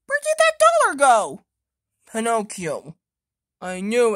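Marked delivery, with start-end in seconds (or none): 0.1-1.4 high, loud, fast alarmed cry; loud high alarmed squeal; shocked shriek. Acted. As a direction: panic about the lost money. Toy shrieks missing dollar.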